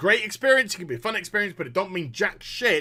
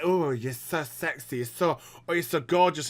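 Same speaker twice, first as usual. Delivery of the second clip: mock seductive sweet talk; 3 semitones lower than usual.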